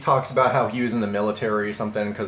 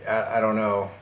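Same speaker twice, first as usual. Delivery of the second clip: ordinary delivery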